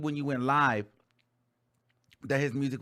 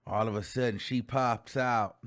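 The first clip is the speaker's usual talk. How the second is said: ordinary talk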